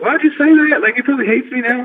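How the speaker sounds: high, loud wail of embarrassment; mock dismayed complaint. Wrestler fretting that his idol hates him now, mock dismayed.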